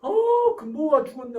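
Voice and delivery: hammed-up fuss over the child's loss; overindulgent fretting. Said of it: "내버려둬 스타일 어떻게 합니까?". (호들갑스럽게 높고 크게 안타까워하며)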